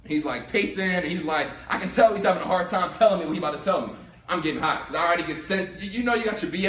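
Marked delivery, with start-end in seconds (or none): none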